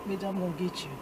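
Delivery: soft, low-pitched mock menace; low threat. Imagined threat during the handshake, low and quiet. Silent threat voiced from a look.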